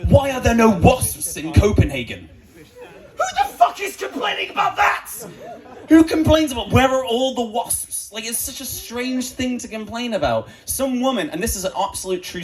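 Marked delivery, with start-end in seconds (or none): 0.1-2.3 high whiny complainer; petulant high whine about nothing. Retold or imitated. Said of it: "he was there like". 3.2-10.1 incredulous high laughing disbelief; laughing high outrage at a silly complaint. Real, not acted. Incredulous ranting about complaint.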